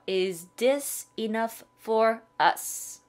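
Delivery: stretched out word by word, extra slow. Shown as a demonstration. Teacher slowing down for learners, extra slow. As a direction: drag each word out so learners catch it. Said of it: "조금 천천히 갈게요".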